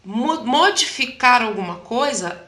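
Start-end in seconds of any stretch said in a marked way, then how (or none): none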